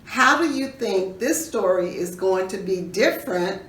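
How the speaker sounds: usual speaking voice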